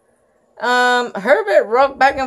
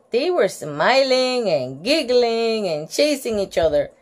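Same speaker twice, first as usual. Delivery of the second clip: usual speaking voice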